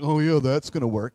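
sarcastic eye-roll. Retold or imitated. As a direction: scoff at the advice. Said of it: "'cause I was really mature".

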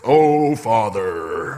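mock-reverent prayer voice with a laugh. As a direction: intone it like a pious prayer. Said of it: "your prayer voice".

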